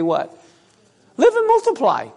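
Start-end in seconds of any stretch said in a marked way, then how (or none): 1.2-2.1 excited high exclamation. Acted. Preacher exclaiming scripture's promise.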